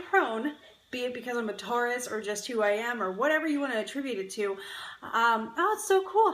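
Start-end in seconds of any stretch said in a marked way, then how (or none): 5.6-6.3 delighted surprise. Real, not acted. Reading a viewer's comment.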